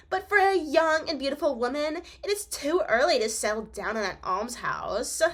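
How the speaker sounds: put-on loud, high, snooty mean girl. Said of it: "I'll give her a mean girl voice".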